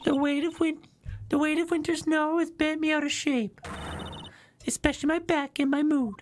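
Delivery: very high put-on complaining voice; witness character voice. Witness complains of aches to the court, high-pitched character voice. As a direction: moan about aches in a tiny high voice.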